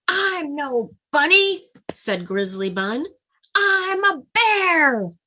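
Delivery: high, loud, slow and proud; proud Grizzly Bun. Acted. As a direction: puff up like a tiny bear. Grizzly Bun insists she's a bear.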